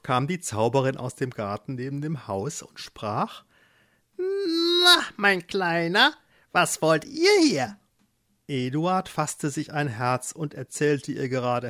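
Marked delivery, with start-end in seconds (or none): 4.2-7.8 hohe, lauernd freundliche Hexenstimme; gönnerhaft wie eine listige alte Hexe; Kräuterhexe. Acted. Hexe empfängt Eduard. (Hexe empfängt Eduard, hoch und gönnerhaft)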